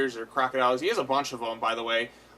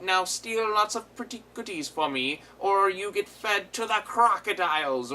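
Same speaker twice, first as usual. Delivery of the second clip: higher villain voice, gloating threat; mock sewer king threat; villain's threat; 6 semitones higher than usual. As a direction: order the kids around like a cartoon villain. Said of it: "has them, like".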